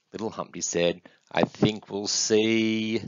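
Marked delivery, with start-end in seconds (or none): 1.3-3.0 very slow, last word stretched out; suspenseful guess. Acted. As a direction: draw it out while thinking up an answer. Little one guesses with suspense.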